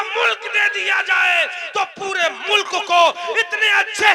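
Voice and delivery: high, very loud hammering sermon; thundering preacher's argument; full-force preaching. Real, not acted. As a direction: drive each clause home to the congregation, shouting.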